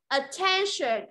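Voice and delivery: "Attention" slowly as a-TEN-shun; slow model of "Attention", weight on TEN; stress on second syllable. Shown as a demonstration. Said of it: "Rơi vào âm tiết thứ hai".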